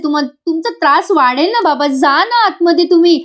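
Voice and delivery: lightly acted daughterly pleading. Daughter urging her father indoors for his health, pleading.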